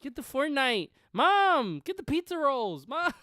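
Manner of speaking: put-on high, loud, demanding kid; boy yelling for Mom. Boys acting out boy time, yelling for Mom. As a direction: holler to Mom in another room.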